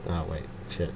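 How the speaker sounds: ordinary delivery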